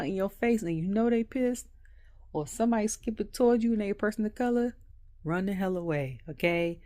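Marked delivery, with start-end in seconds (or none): none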